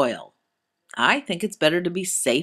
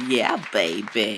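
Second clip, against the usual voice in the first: deep playful voice; 9 semitones lower than usual. Pretend. Host's playful sign-off.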